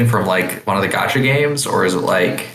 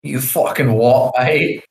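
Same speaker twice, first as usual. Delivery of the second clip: rough London Cockney, pitched up; mock Cockney challenge; Cockney accent; 4 semitones higher, slower than usual. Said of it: "I think it's a Cockney accent she has".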